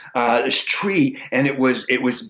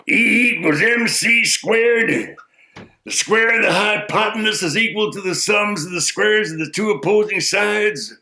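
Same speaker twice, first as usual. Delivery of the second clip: wise man reciting; 7 semitones higher than usual. Acted. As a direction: intone it like a pompous sage.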